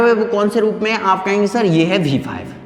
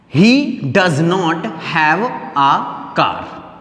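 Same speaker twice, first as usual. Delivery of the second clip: slow dictation; 2 semitones lower, much slower than usual. Shown as a demonstration. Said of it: "अब इसको कहाँ पहुँचा दीजिए".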